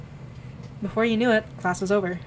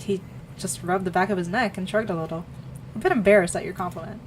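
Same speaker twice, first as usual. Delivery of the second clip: usual speaking voice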